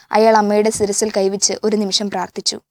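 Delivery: ordinary delivery, nothing marked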